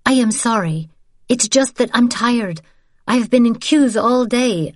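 tired and frustrated, then sheepishly sorry; young woman, weary. Acted. Isabel apologizing to her niece, lightly voiced and weary.

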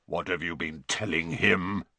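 suspicious centaur growl; menacing, deep-pitched demand; low growl. A narrator's reading. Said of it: "growled Bane".

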